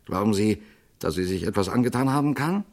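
plain reading voice

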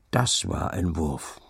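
put-on slow, low old man's approving remark; an old villager praising a throw, lightly. A narrator's reading. Villager praising the young man's throw, a slow low old voice. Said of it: "sagte Zacharias".

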